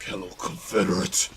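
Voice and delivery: a hint of a raspy, annoyed villain greeting; raspy NPC imitation. Streamer repeating the NPC's line for chat, lightly raspy. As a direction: rasp it out with a bit of disdain. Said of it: "It's, like, all raspy and shit".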